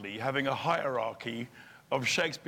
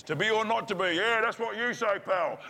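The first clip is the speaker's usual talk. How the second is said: heckling groundling; 8 semitones higher, 3 dB louder than usual. Retold or imitated. Shakespeare audience joining in.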